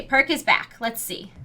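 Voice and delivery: ordinary talk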